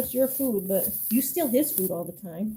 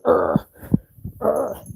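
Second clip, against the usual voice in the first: low, loud growly grumble; imitated dog grumble; 9 semitones lower, 6 dB louder than usual. Owner imitating her dog grumbling at the cat, growly. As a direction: growl it like the dog guarding food. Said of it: "holds him down and goes".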